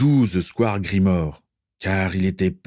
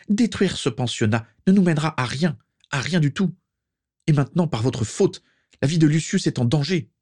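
high, urgent protest; crying out; 8 semitones higher than usual. A narrator's reading. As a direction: plead the danger in a raised pitch. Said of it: "s'écria-t-elle". (protestation aiguë et pressante)

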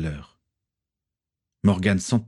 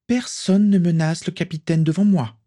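high, forceful threat; fierce warning; 10 semitones higher than usual. A narrator's reading. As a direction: warn him off in a raised, high pitch. Guard threatens while twisting boy's arm.

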